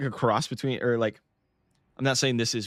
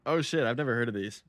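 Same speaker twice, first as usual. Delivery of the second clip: normal talking voice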